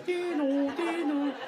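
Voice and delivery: high-pitched two-note train chime; imitated door chime. Train doors blocked by a backpack, imitated chime. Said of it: "the doors just kept going".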